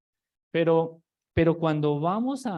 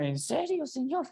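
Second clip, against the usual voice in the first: high, disbelieving "¿En serio, señor?"; incredulous; 9 semitones higher, 4 dB quieter than usual. Retold or imitated. Preacher retelling his reaction to the verse, high and disbelieving. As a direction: ask it high in open disbelief. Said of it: "yo cuando lo leía".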